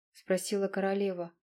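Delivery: plain reading voice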